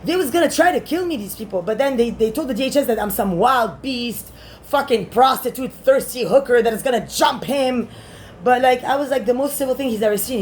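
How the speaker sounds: usual speaking voice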